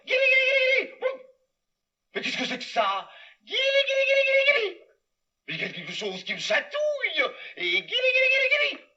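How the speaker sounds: high playful guili guili; high, squeaky teasing singsong; tickling voice. Acted. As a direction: tease him with tickles. Tickling from above.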